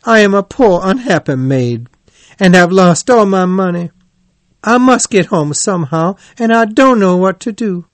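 high, loud, plaintive wail; distraught poor-maid act, pitched well up; pitiful cry. A narrator's reading. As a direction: lay on the misery to win sympathy. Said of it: "cried the Toad".

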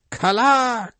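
high, loud chanting cry; wild cry. Acted. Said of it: "cried Queequeg".

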